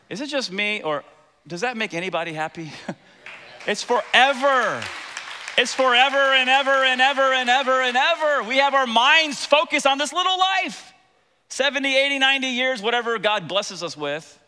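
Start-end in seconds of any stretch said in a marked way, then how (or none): 3.7-14.4 loud, high, exultant proclamation; impassioned preaching. Real, not acted. Preacher rousing the congregation about forever, impassioned. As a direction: pound it out at full preaching force.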